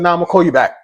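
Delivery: shouting, cutting her off hard; loud retort. Retold or imitated. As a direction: snap back loud and final. Third angry repeat.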